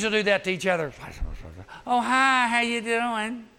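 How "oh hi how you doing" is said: high, slow, sugary fake hello; two-faced greeting. Teens faking friendliness to each other's faces, slow and sugary high. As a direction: greet them sweetly but fake it. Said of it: "Two-faced".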